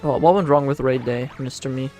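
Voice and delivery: ordinary delivery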